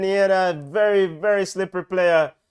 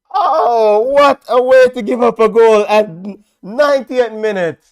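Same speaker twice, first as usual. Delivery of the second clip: despairing; 5 semitones higher, 9 dB louder than usual. Real, not acted. Reacting to late goal.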